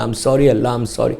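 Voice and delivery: low, soft murmured apology; hushed repentance. Shown as a demonstration. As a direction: show a private word of apology to God. Models a private apology to God. (دھیمی آواز میں معذرت)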